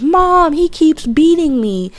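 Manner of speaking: imitating a tattling kid; whiny child voice. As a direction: whine to mom like a child. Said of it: "went to my mom and was like".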